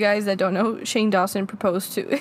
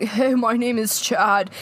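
slow, drawn-out California dude imitation; California San Diego accent; slower than usual. Predicting how the guy will answer, mock California accent. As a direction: stretch it like a San Diego bro. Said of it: "California, San Diego voice".

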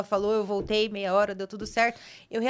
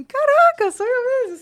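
high-pitched amazed exclamation; reação de surpresa; 13 semitones higher, 4 dB louder than usual. Retold or imitated. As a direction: act out a stunned reveal, voice leaping up. Retells reveal reaction at mirror. (espanto encenado, voz bem aguda)

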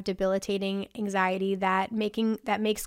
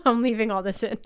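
laughing while she talks. Real, not acted. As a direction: giggle through the words. Laughs at her own burp.

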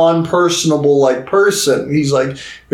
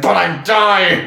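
drawn-out, higher melodramatic protest; mock wail; 4 semitones higher, slower than usual. Patient protesting to those cheering her up, mock wail. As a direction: stretch it into a hammed-up whine.

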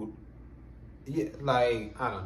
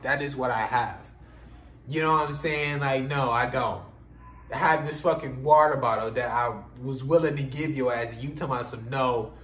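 usual speaking voice

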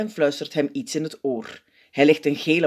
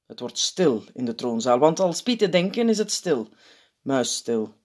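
usual speaking voice

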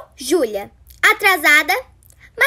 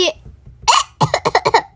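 cough; 6 semitones higher than usual. Acted. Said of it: "minha rinite atacou".

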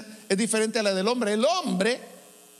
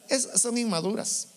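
usual speaking voice

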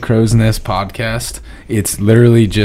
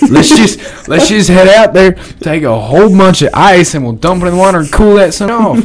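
mock proposal; 7 semitones higher than usual. Mock plan to cool hurricane.

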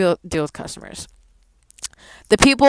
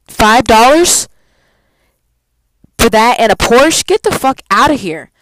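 higher and louder, incredulous; indignant rant; 5 semitones higher, 5 dB louder than usual. Real, not acted. Ranting to viewers about a tiny tip, indignant and loud. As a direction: scoff at the stingy tip, building to a scoff.

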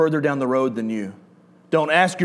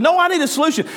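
much higher voice for his wife; wife's reply; 12 semitones higher, faster than usual. Retold or imitated. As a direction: pitch up to play the wife. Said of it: "She said".

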